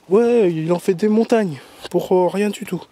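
imitated madame. Imagining what madame will say, mock complaining. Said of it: "il y a madame qui va dire".

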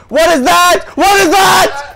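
very high, loud shrieked question; alarmed shout. Real, not acted. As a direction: demand it in a panic, stretching the repeat. Alarmed at unknown creature.

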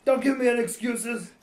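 barking gym teacher orders. Acted. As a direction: push like a strict coach. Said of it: "Sound like my gym teacher".

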